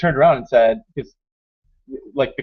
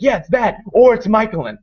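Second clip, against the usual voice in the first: higher-pitched, lively retort; retold eager urging; 8 semitones higher, 4 dB louder than usual. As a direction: act out an eager suggestion.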